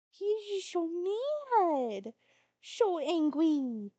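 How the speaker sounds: high, slow cooing pet voice; drawn-out coo over the grumpy cat. Real, not acted. Describing the mad cat cutely.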